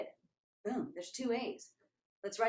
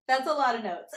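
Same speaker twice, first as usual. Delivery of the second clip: amused protest; 4 semitones higher, 7 dB louder than usual. Retold or imitated. Joking reply amid laughter.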